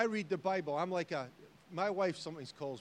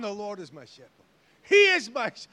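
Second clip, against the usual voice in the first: sudden loud high preacher burst; preaching to myself; 4 semitones higher, 15 dB louder than usual. Retold or imitated. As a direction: preach it to yourself with fire. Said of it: "I start preaching to myself".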